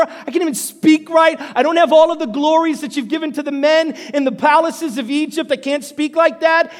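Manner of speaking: high, loud frantic refusal; Moses protesting. Acted. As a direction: argue with God at high pitch, insistently. Acts Moses refusing at burning bush.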